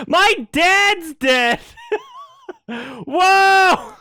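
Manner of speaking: mock sobbing, high and loud; fake-cry over the dead dad for laughs; laughing through a hammed-up wail. Mocking Simba's grief scene.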